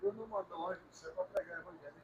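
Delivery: faint voice far from the mic; quiet distant speaker. Real, not acted. Church member adding softly to the pastor. Second speaker barely audible.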